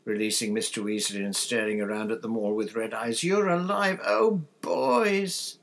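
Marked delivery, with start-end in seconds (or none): 3.2-5.6 very high, loud, emotional cry; overjoyed relief. A narrator's reading. Mother finding her boys alive, tearful relief.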